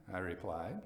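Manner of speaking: plain reading voice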